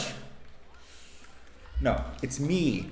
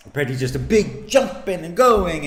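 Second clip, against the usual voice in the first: mocking; 6 semitones higher, 5 dB louder than usual. Real, not acted. Mocking wild fencing style.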